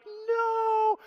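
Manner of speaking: long, high, loud "no"; horrified cry. Retold or imitated. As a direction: cry out in mock slow-motion horror. Retold horror at thrown sand.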